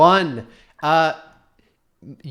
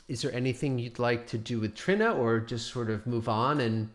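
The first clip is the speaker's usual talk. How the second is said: normal talking voice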